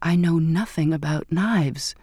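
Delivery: ordinary delivery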